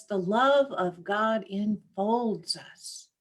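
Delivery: usual speaking voice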